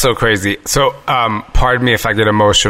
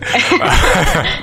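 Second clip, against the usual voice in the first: laughing; 13 semitones higher than usual. Real, not acted. Laughs at meeting only online.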